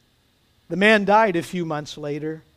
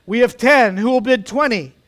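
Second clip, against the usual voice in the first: high, loud bid-calling; auctioneer's call; 5 semitones higher, 6 dB louder than usual. A narrator's reading. Auctioneer calls for higher bids.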